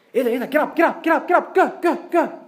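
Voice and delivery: high and loud, repeated command; commanding shout. Retold or imitated. Pope orders the possessed man away, high loud shout. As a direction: drive the demon away.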